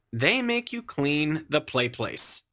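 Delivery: usual speaking voice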